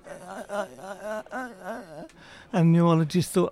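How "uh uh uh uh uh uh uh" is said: stammering husband. Retold or imitated. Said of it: "And the husband went".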